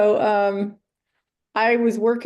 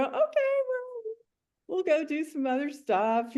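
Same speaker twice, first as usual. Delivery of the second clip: soothing retold reply; 7 semitones higher, 5 dB quieter than usual. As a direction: coo it to calm the horse. Rider retells soothing her horse.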